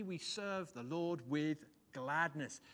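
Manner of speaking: slow, weighty delivery, each word of We serve the Lord with gladness given its weight; measured proclamation of the text; slow solemn emphasis. Real, not acted. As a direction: intone it like scripture. Preacher intones the psalm's key line.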